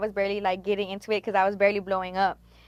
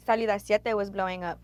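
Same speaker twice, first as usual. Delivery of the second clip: ordinary delivery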